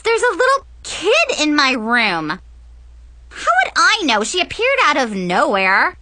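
put-on high, loud bratty teen on the phone; spoiled older girl complaining to her friend; whiny teen girl. Bratty teen complains to Jess on the phone, high and loud.

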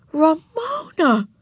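high, drawn-out cry of the name; horrified cry. A narrator's reading. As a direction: cry out slowly in shock, pitched high. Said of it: "cried Beezus, horrified".

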